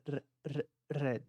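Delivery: RED with a trilled R, tongue on palate; R com língua tremendo. Shown as a demonstration. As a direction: roll the R in RED. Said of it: "minha língua treme no céu da boca". (RED com R vibrado no céu da boca)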